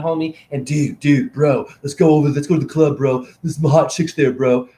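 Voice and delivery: fast, pumped-up bro talk; alpha bro voice. Retold or imitated. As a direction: hype up the guys like a club bro. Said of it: "my alpha straight voice".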